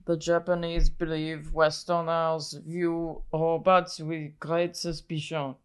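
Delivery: a lightly put-on Israeli accent. Shown as a demonstration. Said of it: "Take two, take two. Channel your daddy".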